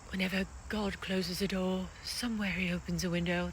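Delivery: badly faked Sound of Music accent; terrible movie accent. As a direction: quote the film in a hammy, bad accent. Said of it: "terrible accent".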